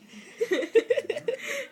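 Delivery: real high-pitched laughing fit; high giggle. Real, not acted. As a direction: burst into high giggles mid-story. Giggles in the middle of retelling.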